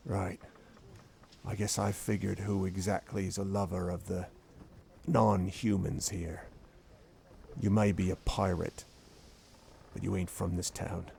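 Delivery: quiet menace to the outsider. Acted. Hack menacing, low.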